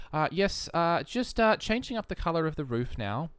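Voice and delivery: put-on well-spoken Australian accent. Well-spoken Australian narrating a house build, refined accent. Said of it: "really well-spoken, and they sort of talk like this".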